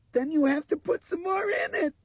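put-on tearful, very high child's yell; shrill scream. A narrator's reading. Girl told the plane lacks fuel, screaming shrilly. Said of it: "Dinah screamed shrilly at him".

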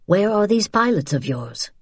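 cooperative question. Acted. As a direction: guide asking without menace. Man now agrees to help.

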